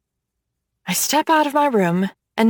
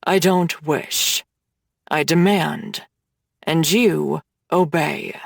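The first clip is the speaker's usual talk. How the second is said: low, slow dominant voice; 7 semitones lower, slower than usual. Acted. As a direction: lay down the law, slow and low. Hades commanding by text.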